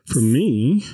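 burp breaking through the words; words pushed out on a burp. Real, not acted. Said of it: "excuse me, whiskey makes me burp".

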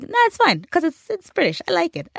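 high-pitched, easygoing brush-off; breezy dismissal. Acted. Imagined listeners brush off offence.

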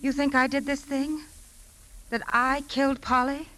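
can't believe what she's hearing; shocked disbelief. Acted. Suspect to the lieutenants, stunned at being accused of killing Polly.

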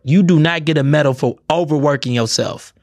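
normal talking voice

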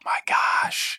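breathy whispered oh my gosh; whispered awe. Real, not acted. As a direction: whisper it in amazement. Awed reaction, whispered.

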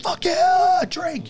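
high, loud whooping cheer of rowdy partiers; drunken party cheer. Retold or imitated. Partiers next door cheering at Trump, loud drunken whoop. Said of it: "they'd be like".

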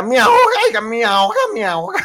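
high, loud squeaky cry of being smothered; mock choking plea. Aleska smothered in a hug, squeaky high complaint. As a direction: shriek it like she's being strangled, fading. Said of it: "nomás faltaba decir a Aleska".

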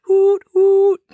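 high, loud imitated hoot; whistle-like high double hoot; drunk owl hoot. Shown as a demonstration. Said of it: "like a drunk owl".

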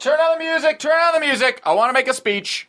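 calling out. Acted. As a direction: call out to the whole room, voice up high. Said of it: "Adam calls out".

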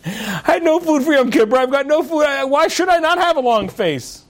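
high-pitched mock outburst of complaint; exasperated protest. Moshe answers relative about his long face, exasperated. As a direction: protest indignantly, pitch held high throughout.

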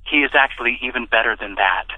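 ordinary delivery

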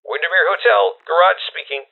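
put-on high, young garage attendant; a boyish kid on the phone, pitched well up. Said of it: "a boyish voice answered".

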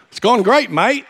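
cheeky Australian reply, lightly put on; mock Australian accent. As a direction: play along as the Aussie pastor in a light Australian accent. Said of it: "You wouldn't know that from my accent".

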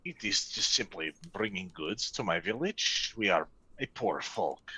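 low, soft-spoken merchant. Acted. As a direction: speak low and humbly as a poor merchant. GM merchant NPC pleading.

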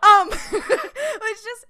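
a loud, high burst of laughter, dragging out the next words; real helpless laughter, barely able to go on; shrieking laugh. Real, not acted. Podcaster cracks up after Ahsoka's flat no, loud high helpless laughter.

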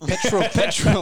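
put-on British accent. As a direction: echo it in a drawn-out British accent. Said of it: "British accent".

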